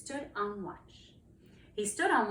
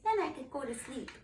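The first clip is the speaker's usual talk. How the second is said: lightly voiced little dragon, higher pitch; pleading little dragon; 7 semitones higher than usual. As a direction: ask like a dragon at bedtime. Young dragon asks to be settled.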